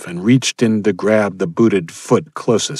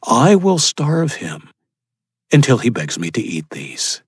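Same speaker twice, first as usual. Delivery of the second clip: gloating cannibal leader; 4 semitones higher than usual. A narrator's reading. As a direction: promise suffering as if chatting. Leader gloats while cutting.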